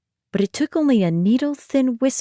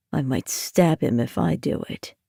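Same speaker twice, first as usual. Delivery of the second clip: grumbling threat; 6 semitones lower, 5 dB quieter than usual. Acted. Nightmare grumbling.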